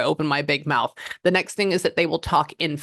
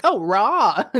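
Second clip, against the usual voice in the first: recognition; 6 semitones higher, 5 dB louder than usual. Retold or imitated. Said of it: "And I'm like".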